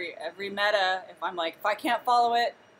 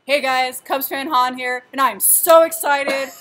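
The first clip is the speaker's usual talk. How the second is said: loud, raised, over-excited intro voice; Cubs fan Han; 4 semitones higher, 10 dB louder than usual. Retold or imitated. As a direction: gush loudly, stretching the excited words. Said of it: "Do your best Cubs fan".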